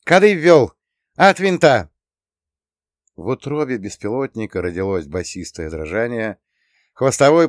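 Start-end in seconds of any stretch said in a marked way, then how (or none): 0.0-1.9 high, loud command; launch shout. A narrator's reading. Operator launches the drone, shouting clipped commands. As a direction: call out sharply before take-off.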